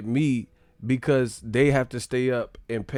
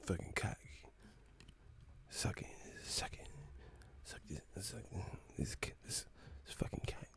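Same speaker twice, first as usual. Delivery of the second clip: unvoiced whispered taunt; 3 semitones lower, 22 dB quieter than usual. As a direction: hiss it as a teasing whisper.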